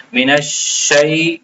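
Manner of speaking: "من الشیء" slow, the shin doubled and pressed. Shown as a demonstration. Teacher showing the stressed shin in "من الشیء". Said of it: "اس کو سختی سے پڑھا جاتا ہے".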